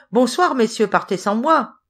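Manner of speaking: storyteller's playful shout; merry call. A narrator's reading. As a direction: call out gleefully, no real laugh. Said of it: "leur cria-t-il en riant".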